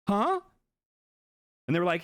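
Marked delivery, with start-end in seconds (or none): 0.1-0.4 high, loud confused huh; mock bewildered huh, much higher and louder; stunned huh. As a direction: react as if you can't believe it. Confused reaction retold.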